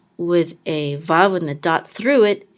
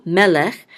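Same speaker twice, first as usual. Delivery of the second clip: melech, mel said louder; stress on first syllable; 7 dB louder than usual. Shown as a demonstration. Teacher repeats melech with first-syllable stress. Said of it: "the accent mark is on the first syllable".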